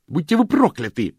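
high, loud piercing shriek; furious high-pitched squeal; shrill shriek. A narrator's reading. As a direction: scream the curse at her. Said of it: "пронзительно взвизгнула Вивьен".

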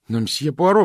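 high, loud, urgent appeal; pleading. A narrator's reading. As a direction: implore him, pitch lifted. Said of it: "взмолилась Джейн". (умоляюще, высоким голосом)

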